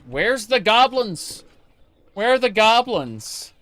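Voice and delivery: high, loud sing-out for the goblins; mock calling out. Streamer hunting goblins in a game, playfully calling out high.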